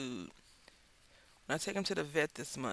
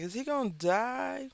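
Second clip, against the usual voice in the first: worried; 9 semitones higher, 5 dB louder, slower than usual. Retold or imitated. Worried owner asks the vet.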